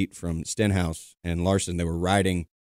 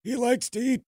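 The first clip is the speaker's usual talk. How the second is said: put-on old man voice; 14 semitones higher than usual.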